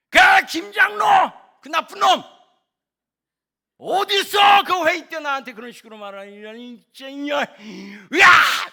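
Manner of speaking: furious high shouting, ending in a roar; 화내는 목사. Acted. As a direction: rant and explode behind closed doors. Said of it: "막 사모님께 막 화를 내는". (집에서 폭발하듯 버럭 화내는 고함)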